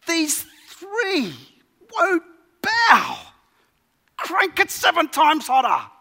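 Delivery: high, loud tyrant bellowing orders; raging king. Acted. Preacher voicing Nebuchadnezzar to the congregation, raging high and loud. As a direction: play the enraged king demanding the furnace hotter.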